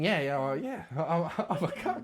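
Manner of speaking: high, laughing mock agreement; going along with the host, half laughing. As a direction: laugh it off while playing along. Mock agreeing on live podcast.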